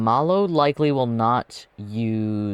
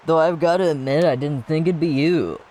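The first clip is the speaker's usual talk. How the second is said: raised pitch, louder rival taunt; higher voice; 6 semitones higher, 4 dB louder than usual. Acted. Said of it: "I look really high".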